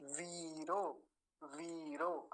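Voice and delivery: वीरो with a drawn-out वी; long ī. Shown as a demonstration. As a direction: hold the first vowel of वीरो. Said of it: "दीर्घ ईकार का ध्यान रखेंगे".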